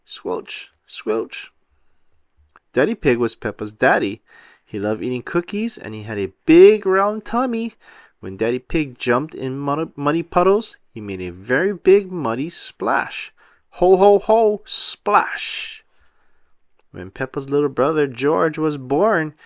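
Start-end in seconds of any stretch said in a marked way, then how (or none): 0.1-1.5 soft breathy squelch like boots in mud; squelch noise. A narrator's reading. Narrator voices boots in the mud, soft squelch. Boot squelch sound effect. 13.8-15.8 big booming ho ho ho then a breathy splash; hearty laugh and splash. A narrator's reading. Narrator voices Daddy Pig jumping, booming laugh.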